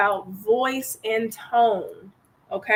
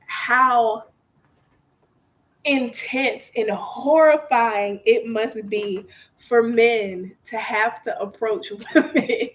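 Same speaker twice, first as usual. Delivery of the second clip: ordinary talk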